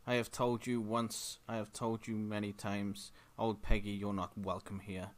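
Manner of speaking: ordinary talk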